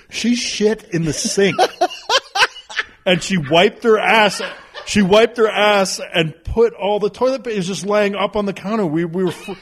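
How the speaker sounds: high-pitched, laughing retelling; amused disbelief. Real, not acted. Dad tells friend what his daughter did, high laughing disbelief. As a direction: crack up while insisting it really happened. Cracking up at his own story.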